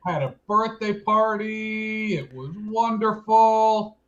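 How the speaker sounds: high, slow sing-song delight; celebratory gushing. Real, not acted. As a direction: stretch it out in a high, gleeful lilt. Carrying on the birthday celebration.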